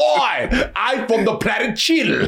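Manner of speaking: mock Cockney, hammed up; bad Cockney accent. Host mocking an actor's film accent, exaggerated bad Cockney. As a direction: mangle it in a terrible Cockney. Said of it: "that Cockney accent".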